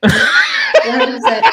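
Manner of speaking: a big laughing yes; laughing agreement. Real, not acted.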